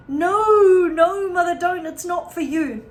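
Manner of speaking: high, loud urgent protest; alarmed daughter. A narrator's reading. Missus Cranky stopping her mother from drinking, high and alarmed. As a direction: cry out to stop her.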